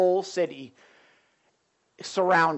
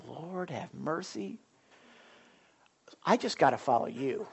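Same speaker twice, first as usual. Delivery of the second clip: inner prayer; 4 semitones lower, 9 dB quieter than usual. Retold or imitated. Pastor recalling his private prayer, softly. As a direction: talk quietly to the Lord, slowed down. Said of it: "I'm thinking".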